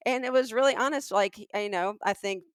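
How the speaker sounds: usual speaking voice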